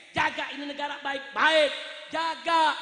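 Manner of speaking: loud, hammering political oratory; impassioned shouted demands to a leader; forceful admonishment. Real, not acted. Admonishing the President before crowd.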